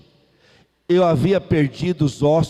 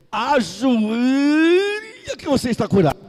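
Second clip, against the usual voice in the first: commanding call; 7 semitones higher than usual. Retold or imitated. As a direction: order him to kneel, stretching it out. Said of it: "fizemos assim". (ordem arrastada e mais aguda para ajoelhar)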